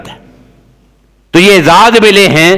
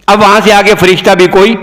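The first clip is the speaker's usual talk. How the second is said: ordinary talk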